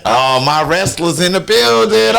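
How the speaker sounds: proud announcement. Retold or imitated. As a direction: announce his wrestlers like a proud coach. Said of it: "he was like".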